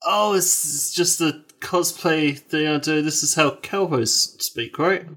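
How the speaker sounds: a hint of a Western twang, mostly his own voice; cowboy voice. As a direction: play the cowboy, half-hearted. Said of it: "that's a good cowboy voice".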